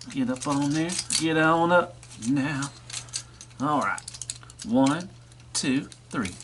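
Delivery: slow, soft coaxing; coaxing toy voice. Pretend. A toy coaxes another to climb on, slow soft coaxing. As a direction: ease him on like a rodeo hand.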